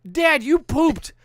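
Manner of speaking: high mock-daughter voice; insistent daughter. Daughter tells dad to go check, insistent. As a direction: imitate a child stating it pointedly, phrase by phrase.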